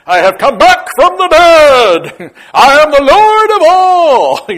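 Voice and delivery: booming, drawn-out mock-divine shout; movie-epic proclamation. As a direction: proclaim it slowly, high and very loud, like a film Messiah. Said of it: "in the movies it'd be".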